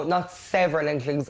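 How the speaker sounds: several leaned on as a correction; stress on several. Real, not acted. Housemate correcting himself, stress on several. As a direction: take back the word several by stressing it.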